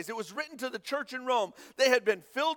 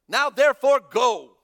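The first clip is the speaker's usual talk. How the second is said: loud firm command; God sends Moses out, with a loud command; 11 dB louder than usual. Acted. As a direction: order him to go, booming.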